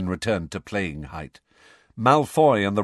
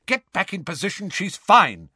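sharp, raised captain's order; barked order; 9 semitones higher, 4 dB louder than usual. Acted. Angelina ordering Ron back after he hits Katie, barking. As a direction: snap him back into place. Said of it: "barked Angelina".

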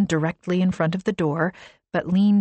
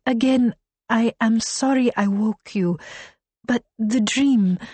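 put-on Arabic accent; 5 semitones higher than usual. A narrator's reading. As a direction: struggle gently with the English. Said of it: "Her voice struggled with the English".

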